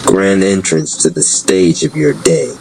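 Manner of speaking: ordinary delivery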